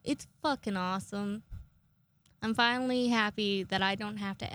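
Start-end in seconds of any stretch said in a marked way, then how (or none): none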